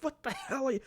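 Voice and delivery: high-pitched, baffled viewer reaction; shocked disbelief. Acted. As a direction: squeak it out in mock shock.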